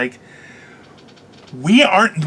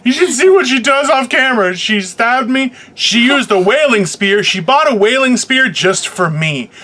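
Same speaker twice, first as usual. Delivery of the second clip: mock outrage; 8 semitones higher, 4 dB louder than usual. Jokey mock accusation of cohost.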